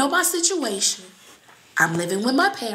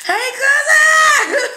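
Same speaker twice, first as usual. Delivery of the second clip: long drawn-out shout of cousin, much higher and louder; calling out; 10 dB louder, much slower than usual. Real, not acted. Greeting her cousin on camera with a long drawn shout. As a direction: holler it across the room, stretching it out.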